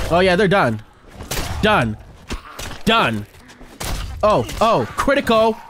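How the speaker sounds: ordinary delivery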